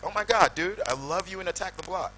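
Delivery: high, excited gushing American fan voice; Tom Cruise impression. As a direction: gush at him like a starstruck Hollywood star. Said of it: "and it's Tom Cruise".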